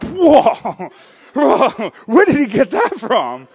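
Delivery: high, loud, laughing amazement; a burst of delighted disbelief; amazed whoa. Retold or imitated. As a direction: gasp it out like your kid just floored you. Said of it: "where I'm like".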